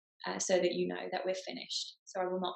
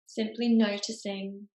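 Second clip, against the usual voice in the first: guided meditation. Acted. Meditation teacher settling the group into their bodies, guided meditation. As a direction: ease them into stillness, phrase by unhurried phrase.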